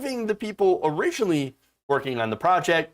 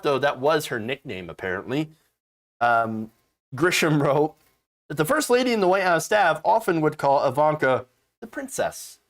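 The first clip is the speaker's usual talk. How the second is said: normal talking voice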